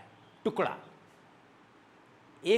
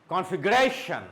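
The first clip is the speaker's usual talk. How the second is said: configuration with RA raised, then falling; stress on RA. Shown as a demonstration. Teacher drilling T I O N words, stress on RA. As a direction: punch the RA in configuration. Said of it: "it should go up".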